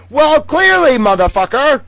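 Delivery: high, loud punchline jab; mock retort. Real, not acted. Streamer mocking Koala's excuse, high and loud.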